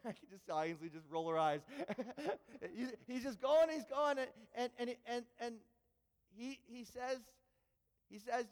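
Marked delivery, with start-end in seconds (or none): none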